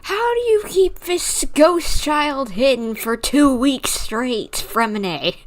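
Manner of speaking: can't believe how he hid her; incredulous outburst. Real, not acted. Streamer reacts to game twist.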